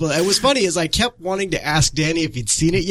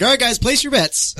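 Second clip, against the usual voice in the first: hosting voice; 9 semitones higher than usual. Retold or imitated. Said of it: "He's like".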